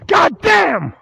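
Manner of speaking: very loud, high outburst; pissed-off shout. Real, not acted. Pissed-off man cussing out the church, explosive shout. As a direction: explode in a real angry shout. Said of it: "I'm pissed off".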